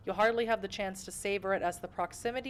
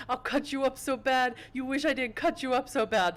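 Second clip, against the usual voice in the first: higher, hammed-up tough-guy threat; put-on thug voice; 7 semitones higher, 5 dB louder than usual. Player quotes a joke threat to viewers, hammed-up thug voice. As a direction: ham up a silly thug's threat. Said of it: "That's exactly the voice I was doing".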